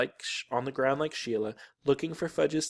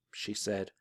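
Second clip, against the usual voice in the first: plain reading voice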